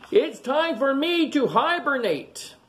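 high, slow storybook yell. A narrator's reading. Bear announces hibernation to everybody, yelling. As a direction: call it out high and drawn out. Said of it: "Then he yells to everybody".

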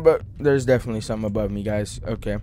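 ordinary delivery

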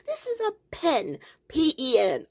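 squeaky high, laughing mock lecture; high-pitched teasing correction. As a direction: protest in a very high voice, tickled by your own joke. Mock-correcting Southern pronunciation, laughing.

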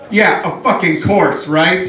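high, loud jeering cheer; mock riled-up friends. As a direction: imitate friends egging each other on. Vegan friends jeer at narration.

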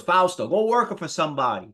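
imitating an Italian-born father; Italian accent. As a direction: put on Dad's Italian accent. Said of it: "with this Italian accent".

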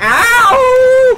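high yelp of mock pain; pained shout. Real, not acted. Hit in the game.

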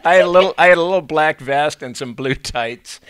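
giggling while telling about the costume; laughing. Real, not acted. Recalling his boyhood costume to the interviewer, laughing.

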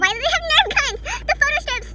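high, loud amazed outburst; excited discovery. Real, not acted. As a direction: exclaim over each new find. Discovers items while unboxing.

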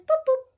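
high, loud little pops; playful boops. Acted. Showing children the blackbirds landing, playful boops. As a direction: pop the birds onto the wire.

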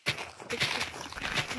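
soft whisper coaxing a chicken; whispered calling. Real, not acted. Player searching for a pet chicken, whispering.

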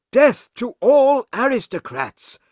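high, loud rallying cry; fierce storybook chant; crowd's shout. Acted. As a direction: shout it like a revolutionary mob. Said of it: "they shout".

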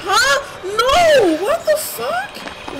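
very high, loud shriek, the last words stretched slow; terrified shriek. Real, not acted. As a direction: shriek in terror as it comes at you. Monster appears, panic.

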